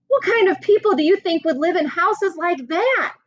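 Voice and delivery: high, lively wondering; eager kid's question. Acted. Emilio wonders aloud to his group about the people, high and eager. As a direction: ask it with bright curiosity. Kid curious about photo.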